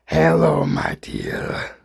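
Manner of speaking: a hint of the Goblin, whispering the last words; creepy villain mutter. The Goblin greets Mary Jane, imitated with a creepy whisper. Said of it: "He literally straight mutters the words".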